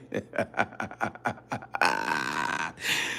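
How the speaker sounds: long, quiet trailing laugh; real laughter. Real, not acted. After hanging up again in story, long laugh. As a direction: laugh helplessly at your own story.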